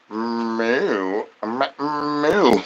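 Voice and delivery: quiet mock cow moo; low soft moo. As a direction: moo like a sleepy bull. Imitated bull noise.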